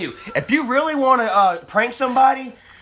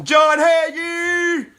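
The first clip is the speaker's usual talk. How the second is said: shouted greeting; 8 semitones higher, much slower than usual. Real, not acted. Calling out a viewer's name.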